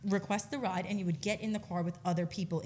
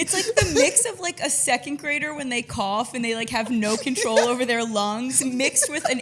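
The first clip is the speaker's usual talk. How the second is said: excited describing; 2 semitones higher, 7 dB louder than usual. Real, not acted. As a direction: get it out while laughing. Describing cough, laughing excitedly.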